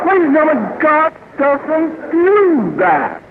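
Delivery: high, drawn-out exasperated declaration; comic protest. Acted. Speaker correcting audience after the boy's prayer, comic protest. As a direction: spell it out high and very slowly.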